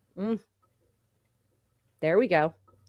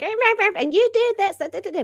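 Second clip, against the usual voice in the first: mocking imitation; 12 semitones higher than usual. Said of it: "She's like".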